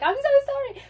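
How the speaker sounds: high, cracking tearful sorry; tearful apology. Retold or imitated. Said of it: "And I started crying".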